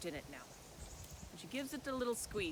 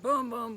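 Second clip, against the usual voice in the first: heavy stomping booms, louder; loud booming steps; 10 dB louder than usual. Acted. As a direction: boom it out loud like giant footsteps. Troll's footsteps.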